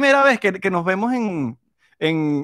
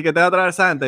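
ordinary talk, nothing marked

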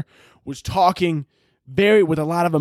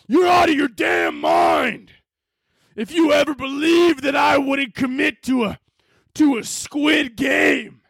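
overacted dub shout; 11 semitones higher, slower than usual. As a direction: scream it like an over-committed voice actor. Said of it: "I would have been like".

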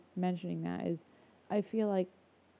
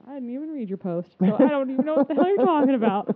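exasperated retort; 7 semitones higher, 10 dB louder than usual. Retold or imitated. Venting an unspoken comeback to a friend, exasperated and rising. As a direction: voice climbing into a loud, high outburst.

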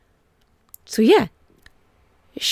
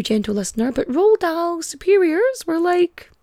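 normal talking voice

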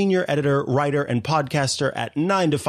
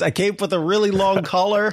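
ordinary talk, nothing marked

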